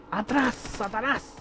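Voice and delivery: slow, high dramatic banishing; hammed-up begone demon; mock exorcist cry. Fleeing monster in horror game.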